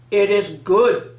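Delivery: loud, slow booming voice from above; big voice. Acted. Mysterious voice calling through trees, big and booming. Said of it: "said the big voice through the trees".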